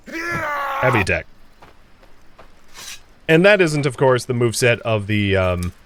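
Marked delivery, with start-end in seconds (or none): 0.1-1.3 heavy attack pitched much higher, slow and falling; drawn-out high call. Shown as a demonstration. Showing viewers the axe move, drawn-out high call. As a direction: sing out heavy attack like a big swing. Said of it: "So here is the".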